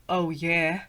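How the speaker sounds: low lightly voiced werewolf. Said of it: "should I do a werewolf voice".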